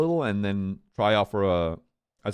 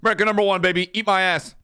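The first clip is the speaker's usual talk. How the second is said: triumphant shout; 9 semitones higher, 6 dB louder, faster than usual. Real, not acted. Celebrating after a prank.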